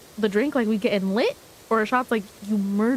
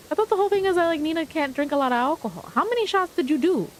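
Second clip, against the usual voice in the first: surprised; 6 semitones higher, faster than usual. Real, not acted. Reacting to shots news.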